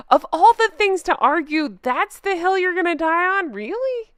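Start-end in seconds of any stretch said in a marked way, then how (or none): 3.5-4.1 a high, disbelieving "really?"; can't believe this argument; incredulous. Real, not acted. Vlogger doubting the commenter's chosen hill, high disbelief.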